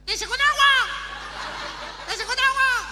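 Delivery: very high, very loud taunting shout; mocking yell. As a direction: heckle him from the roadside. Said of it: "le gritan".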